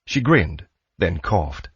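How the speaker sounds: ordinary delivery, nothing marked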